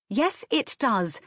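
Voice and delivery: a bit of sharpness, drawn out; slightly sharp. A narrator's reading. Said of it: "said a bit sharply".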